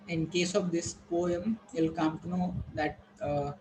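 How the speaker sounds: usual speaking voice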